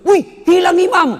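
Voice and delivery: loud, very high-pitched yell of alarm; startled shout. Retold or imitated. Friend lifting his head to find the imam gone, shouting. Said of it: "Langsung teriak".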